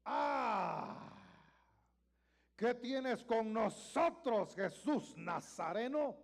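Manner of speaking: loud, slow, drawn-out demon cry; loud slow demonic shout. Acted. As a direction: bellow it like a demon in the synagogue. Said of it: "dio voces".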